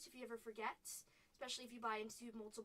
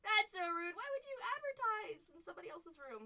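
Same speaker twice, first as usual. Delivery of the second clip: indignant; 5 semitones higher than usual. Real, not acted. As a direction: call out the rude advertiser. Annoyed at spam ad.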